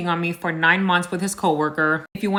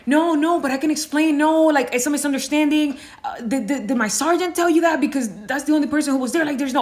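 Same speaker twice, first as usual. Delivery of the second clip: high, hurried mock pleading; panicked rambling husband; 7 semitones higher than usual. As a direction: babble excuses, caught out and scrambling. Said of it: "he just started rambling".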